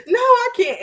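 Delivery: high, laughing wail; mock crying. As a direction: act out a flustered tearful outburst. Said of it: "like, crying and crying and crying".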